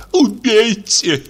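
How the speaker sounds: a high, slow, drawn-out cry. A narrator's reading. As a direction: stretch the word out in a high wail. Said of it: "крикнула Ольга Ивановна".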